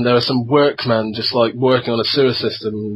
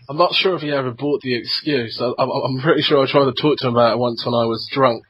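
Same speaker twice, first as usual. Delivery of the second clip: ordinary talk, nothing marked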